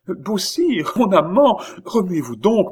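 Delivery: high-pitched, loud, frantic plea; panicked urging. A narrator's reading. Oliva urges the stranger to move, panicked. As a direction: press him urgently, voice pitched right up.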